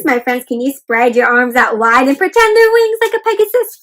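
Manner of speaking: ordinary delivery, nothing marked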